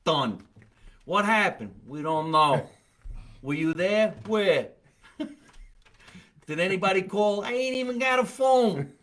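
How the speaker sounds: punchy mobster ad spokesman; hammed-up mobbed-up attorney; mob lawyer pitch. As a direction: deny everything like a mob lawyer. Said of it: "look like they're in the mob".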